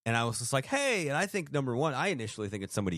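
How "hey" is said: squeaky high-pitched hey back; startled high hello. Retold or imitated. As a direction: chirp the greeting back much higher. Speaker answers greeting, pitched up.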